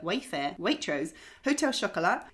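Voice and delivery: usual speaking voice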